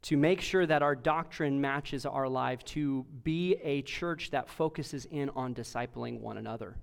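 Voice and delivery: low, soft, earnest praying voice; hushed pulpit prayer, falling away at phrase ends; opening prayer. Real, not acted. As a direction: pray humbly on behalf of the congregation.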